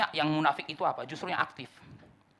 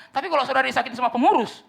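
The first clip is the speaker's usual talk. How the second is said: much higher and louder; preacher building to a loud peak; emphatic outburst; 10 semitones higher, 9 dB louder than usual. Real, not acted. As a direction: raise the voice sharply.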